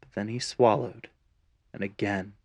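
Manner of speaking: plain reading voice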